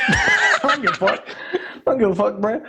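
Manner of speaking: laughing through a defiant boast; cracking up as he shrugs it off. Real, not acted. Bragging to his cohost about honesty, laughing.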